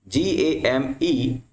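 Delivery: ordinary delivery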